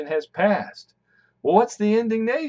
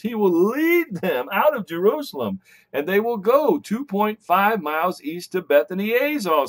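ordinary talk, nothing marked

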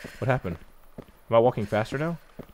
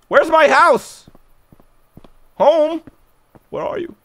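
calling out; 14 semitones higher, 6 dB louder, slower than usual. Real, not acted.

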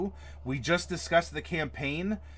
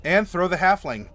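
usual speaking voice

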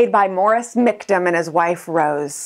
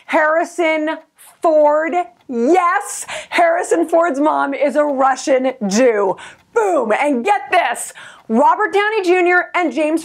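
high, thrilled triumphant listing; building to a gleeful boom; excited; 8 semitones higher than usual. Real, not acted. Mom bragging about Harrison Ford, high and triumphant.

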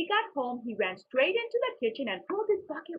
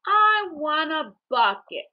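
slow, firm and louder; insistent; 5 semitones higher, 7 dB louder, slower than usual. A narrator's reading. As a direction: dig in and insist. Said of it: "said Billy".

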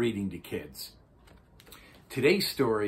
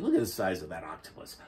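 usual speaking voice

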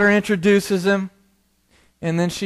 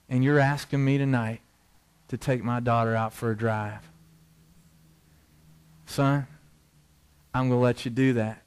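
grave fatherly talk; 5 semitones lower, 6 dB quieter than usual. Retold or imitated. Father quietly warning his daughter's date, grave and tender. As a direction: lay down the terms quietly and firmly.

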